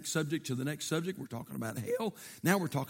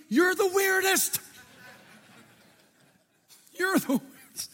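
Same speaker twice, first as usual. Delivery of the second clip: teasing; 11 semitones higher, 3 dB louder than usual. Real, not acted. Teasing the congregation.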